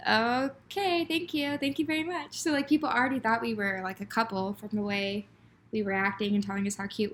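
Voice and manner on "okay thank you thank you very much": bashful thanks. Retold or imitated. Retold bashful reply to compliment.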